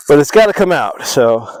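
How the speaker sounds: breathing hard while working; puffing between words; heavy breathing. Real, not acted. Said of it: "Sorry for all the heavy breathing".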